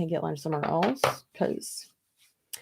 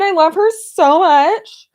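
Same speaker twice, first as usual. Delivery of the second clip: louder, higher, slowed gush of affection; 10 semitones higher, 12 dB louder, slower than usual. Real, not acted. Vlogger raving about her friend Christine, loud and gushing. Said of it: "my friend Christine".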